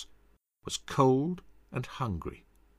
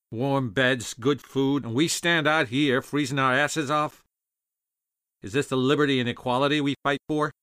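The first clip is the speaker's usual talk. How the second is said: griping; 3 semitones higher than usual. A narrator's reading.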